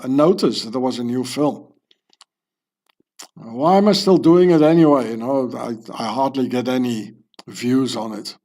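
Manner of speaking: usual speaking voice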